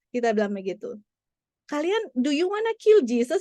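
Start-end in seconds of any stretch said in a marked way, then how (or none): 1.6-3.4 retold question to children. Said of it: "Kita tanya".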